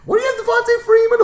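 sudden high squeaky fast voice; mocking squeal. Roasting his friend's roster, a high mocking squeal. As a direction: squeal it at him in mock disbelief.

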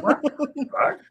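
high-pitched mock disbelief; imitating flabbergasted friends; astonished. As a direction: squeal it in stunned amazement. Retold friends' astonished reaction.

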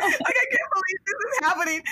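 high, tearful mock wail. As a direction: whine it like you're about to cry.

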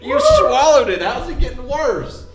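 laughing disbelief. Real, not acted. Laughing at friend's pain.

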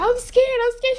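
high, quick imitation of a scared man; mock frightened. Storyteller mimics guy clinging to her sister, mock frightened. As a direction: blurt it high, pretending panic. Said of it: "He was like".

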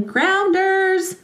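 imitating a child's high, happy yell; a child's excited shout at play, imitated. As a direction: call out "Grounders!" high, like a gleeful kid. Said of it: "she's happily yelling".

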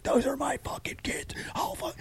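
whispered scream, high and strained; mock strangled yell. Father pointing out his kids to a speeder, hushed mock scream. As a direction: imitate fury with the volume turned down.

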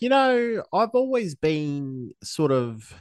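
high, slow imitation of Joel; mimicking a friend's high-pitched voice; imitating Joel. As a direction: quote him pitched well up, drawn out. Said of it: "Joel was saying something like".

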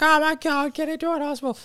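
high, frantic worried mother; panicked mum. Retold or imitated. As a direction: fuss like an anxious mum. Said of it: "my mum would have called up and be like".

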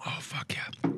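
breathy whispered relief after a drink; whispered relief after gulping water. Real, not acted. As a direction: whisper it, refreshed.

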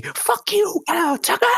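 high, loud heckling shout; heckling yell. Retold or imitated. Bleacher fans screaming at Kyle Tucker, high and loud. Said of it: "I've never heard so many".

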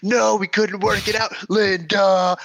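high, loud mock shout at Linda; imitating Bob. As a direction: yell it up high, done with her. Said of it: "I just love that he's like".

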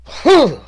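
sudden high, loud wordless exclamation; embarrassed outburst. Retold or imitated. Driving home remembering the embarrassment, acted high wordless outburst. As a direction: re-enact a sharp burst of remembered embarrassment. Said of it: "how embarrassed I was".

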